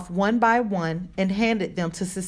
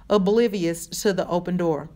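usual speaking voice

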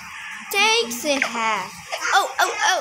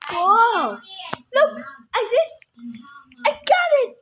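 excited; 4 semitones higher than usual. Real, not acted. Excited discovery in game.

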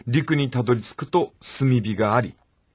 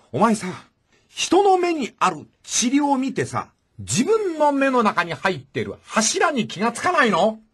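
higher, slower Tora-san street talk; Tora-san impression; 9 semitones higher, slower than usual. Retelling Jesus's sermon for the listener as brash, higher Tora-san. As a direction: play the brash Shitamachi peddler Tora-san. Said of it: "寅さんっぽく言うと".

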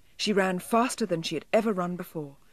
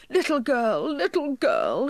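crying elf; 7 semitones higher, 4 dB louder than usual. A narrator's reading. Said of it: "he cried".

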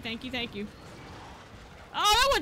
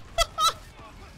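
short high burst of laughter; 13 semitones higher than usual. Real, not acted. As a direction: crack up after shouting. Laughs after the outburst.

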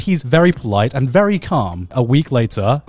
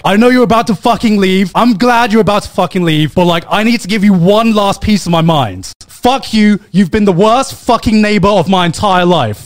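higher, louder furious rant all the way; imitated screaming; 7 semitones higher, 5 dB louder than usual. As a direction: scream it right in his face. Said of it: "like, screaming in my face".

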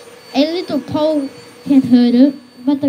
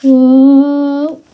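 whoa; 9 dB louder than usual. A narrator's reading. Riding the bucking hippo.

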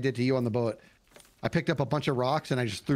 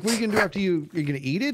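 higher-pitched, incredulous questioning; half-mocking disbelief, pitched up; incredulous challenge; 6 semitones higher, much faster than usual. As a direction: retell it as a baffled challenge. Retold incredulous challenge to friend.

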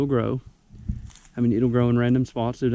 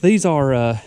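normal talking voice

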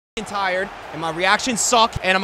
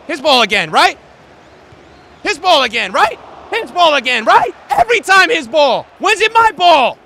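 repeated complaint climbing higher and louder; exasperated, building to yelling; 6 semitones higher, 8 dB louder than usual. Real, not acted. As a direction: start loud and escalate to a high shout.

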